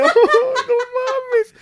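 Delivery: extremely high, drawn-out sobbing wail; mock wail. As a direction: stretch it into a comic wail. Retelling crying at slide. (lamento exagerado, muy agudo y lento)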